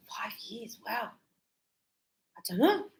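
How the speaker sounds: surprised whisper, unsure. Retold or imitated. Student stumped by teacher's question, whispering. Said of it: "Joe goes".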